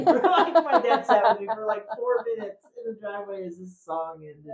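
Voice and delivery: high, laughter-filled storytelling; laughing while talking. Real, not acted. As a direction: tell it through your laughter. Laughing through own childhood anecdote.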